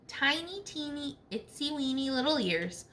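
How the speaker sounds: ordinary delivery, nothing marked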